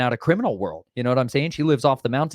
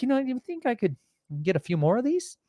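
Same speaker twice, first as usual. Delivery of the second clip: coaxing ask; 13 semitones higher, 4 dB quieter than usual. Retold or imitated. Sweet-talking the cashier.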